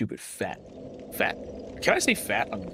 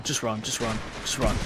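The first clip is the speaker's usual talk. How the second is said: ordinary talk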